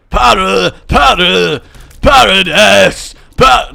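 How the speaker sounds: loud, high guttural Scottish singing; Scottish accent. Pretend. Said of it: "Especially if it was Scottish".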